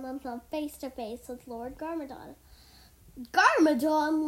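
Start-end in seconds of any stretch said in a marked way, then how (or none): 3.3-4.3 loud, higher-pitched shout; shouted name. A narrator's reading. Lloyd comes face to face with his foe, shouting. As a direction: shout it in confrontation. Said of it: "Lloyd shouted".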